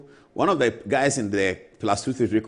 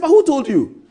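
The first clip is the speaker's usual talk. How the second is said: sharp demand; 11 dB louder than usual. Retold or imitated. Lawyer pressing him hard.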